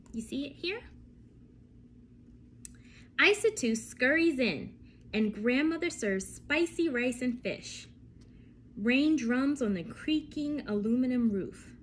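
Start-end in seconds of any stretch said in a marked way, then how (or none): 3.2-11.7 lively storybook reading with vivid pauses; reading to children. Acted. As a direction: read it to a class of little ones.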